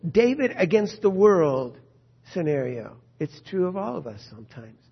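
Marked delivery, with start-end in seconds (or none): none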